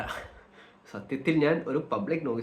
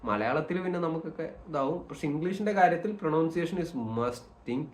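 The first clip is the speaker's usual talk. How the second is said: ordinary talk, nothing marked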